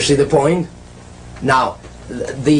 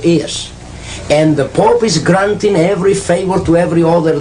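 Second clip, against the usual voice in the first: louder, driving sermon delivery; fervent preaching; 4 dB louder than usual. Acted. Preacher warning the audience about the Pope, fervent and louder. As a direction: preach the warning hard to the flock.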